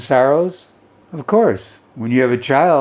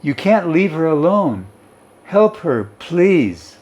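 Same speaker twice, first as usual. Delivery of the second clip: higher, urgent pleading; pleading cry; 6 semitones higher than usual. A narrator's reading. Daughter begs her mother to help her sister, higher urgent plea. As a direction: beg her not to go. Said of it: "I cried".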